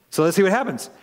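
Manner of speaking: ordinary delivery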